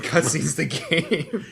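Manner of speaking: slow, laughing repeat. Real, not acted. Co-host repeats the joke, laughing slowly. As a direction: chuckle through it.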